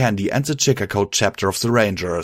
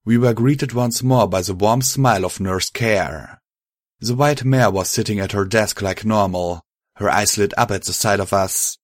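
usual speaking voice

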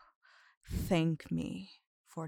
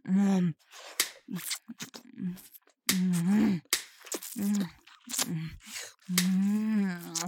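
moaning; 5 dB louder, much slower than usual. Acted. Aroused moaning in erotic roleplay.

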